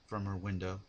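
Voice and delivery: usual speaking voice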